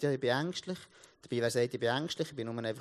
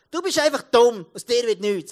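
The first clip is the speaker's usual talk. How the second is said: loud, raised-pitch scolding; harsh teacher's put-down; 7 semitones higher, 12 dB louder than usual. Retold or imitated. Teacher putting down a young pupil, loud harsh scolding. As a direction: imitate a teacher berating a pupil loudly.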